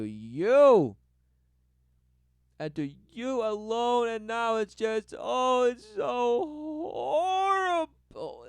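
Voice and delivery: loud, high, drawn-out mock sobbing; hammed-up wail, stretching the vowels. Mocking someone who feels completely alone, wailing.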